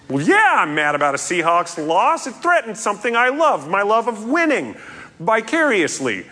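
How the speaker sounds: high-pitched, worked-up self-justification; hammed-up indignant venting; mock fan outrage. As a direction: protest like an aggrieved sports fan. Acts out anger at team's loss.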